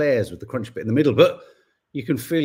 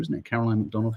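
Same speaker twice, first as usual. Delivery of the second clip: ordinary talk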